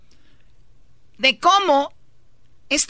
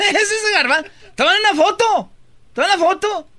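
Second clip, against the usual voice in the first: high, loud, laughing jab; mock him through laughter; laughing tease; 5 semitones higher, 3 dB louder, faster than usual. Real, not acted. Teasing Garbanzo for a photo, loud laughing jab. Gleeful mockery of cohost.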